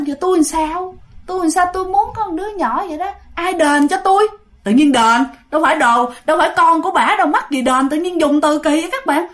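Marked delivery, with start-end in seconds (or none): 0.1-4.3 higher-pitched, mildly whiny complaint; lightly voiced ghost, plaintively pressing her case; aggrieved ghost woman. Ghost woman demands compensation from the little man, mild plaintive complaint. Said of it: "Bả, bả nói cái trong đó đó".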